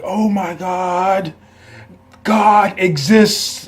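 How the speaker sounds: drawn-out, high, hammed-up yell; exaggerated fanboy rapture at full volume; mock ecstatic shout. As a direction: overdo the awe, loud and slow. Mock over-the-top fan reaction.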